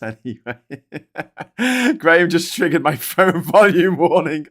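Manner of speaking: chuckling through the words; laughing. Real, not acted. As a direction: laugh at yourself while talking. Laughing at own shouting.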